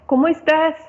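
light greeting of a friend; asking how you are. Acted. Asking a person how they are.

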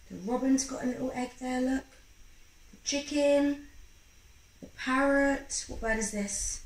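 ordinary delivery, nothing marked

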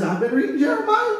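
very high imitation of a churchwoman; Mother Ada. Church mother reporting to her pastor, high-pitched imitation. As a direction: mimic her announcement in a lifted, high pitch. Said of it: "She says".

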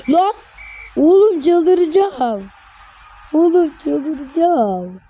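you're losing your mind over this; exasperated shout. Real, not acted. Gamer raging at his cup draw, slow exasperated yell.

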